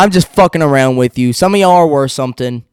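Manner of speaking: ordinary talk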